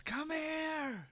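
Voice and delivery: very high, eager call; excited prince call. Acted. Prince calling Raz over from the railing, very high. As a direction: beckon him over like an excited young prince.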